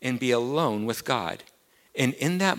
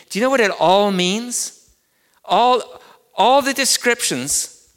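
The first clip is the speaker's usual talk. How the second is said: higher and louder, driving sermon voice; full-force preaching; 6 semitones higher, 6 dB louder than usual. Acted. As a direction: preach it out to the whole room.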